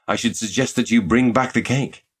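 ordinary delivery

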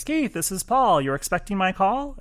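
a hint of McCartney's Liverpool accent; imitating [name]. Host quoting McCartney's phone greeting, light British accent. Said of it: "he says".

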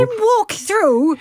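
high, slow disbelief; incredulous. Real, not acted. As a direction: repeat it in mock horror. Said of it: "riddled with typos".

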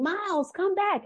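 high mock call after a running child; retold yell. Grandmother yelling after her running grandson, high retold call. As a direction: call him back like he's across the lot. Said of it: "And I'm yelling".

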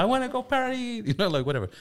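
high-pitched mock party whine; imitated excited kid. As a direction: blurt it like a kid bursting to play. Dad mimics son dropping the politeness.

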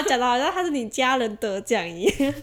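ordinary talk

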